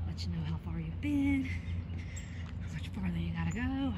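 winded from the walk; breathless, puffing between words; out of breath. Real, not acted. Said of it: "I am so out of breath".

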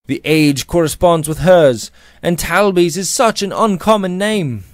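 high, loud, distressed insistence; crying out. A narrator's reading. George argues it must be his wife, crying out. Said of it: "he cried".

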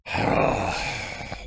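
breathless wheezing laugh; laughing hard. Real, not acted. Reacting to anime scene.

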